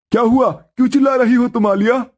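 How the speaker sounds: husband calling his wife to serve the food; husband's voice. Acted. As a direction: play the cheerful husband bringing food home.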